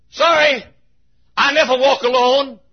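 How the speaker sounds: usual speaking voice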